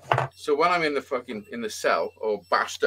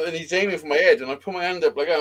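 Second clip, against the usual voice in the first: normal talking voice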